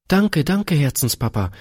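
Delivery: put-on a little girl thanking her papa in delight; overjoyed child. A narrator's reading. Daughter overjoyed her father said yes, high excited thanks. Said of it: "rief Gretchen überglücklich".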